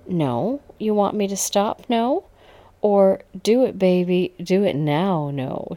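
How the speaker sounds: playful taunting lilt; teasing. A narrator's reading. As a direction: tease him with each question. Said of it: "she said teasingly".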